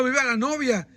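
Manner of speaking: usual speaking voice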